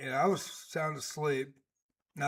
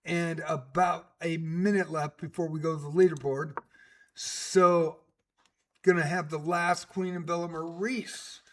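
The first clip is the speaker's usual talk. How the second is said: punchy chess commentator delivery; live play-by-play; 3 semitones higher, 6 dB louder than usual. Real, not acted. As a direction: call the match with lively, emphatic announcer energy. Calls live chess games.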